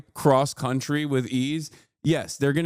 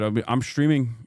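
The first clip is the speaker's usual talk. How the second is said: ordinary delivery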